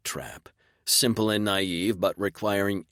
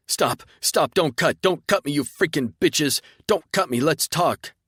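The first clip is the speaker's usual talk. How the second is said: high, loud frantic pleading; panicked begging; 9 semitones higher, 6 dB louder than usual. Acted. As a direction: beg for your life in a rush. Tentacle monster begs not to be cut.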